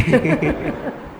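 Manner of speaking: light mock giggle; a light imitated chuckle; retold giggle. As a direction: giggle softly as the lady did. Lady's amused laugh retold.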